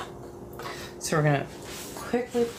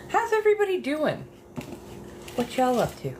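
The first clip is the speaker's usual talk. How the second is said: cheery greeting; 10 semitones higher than usual. Real, not acted. Greeting live stream viewers, high.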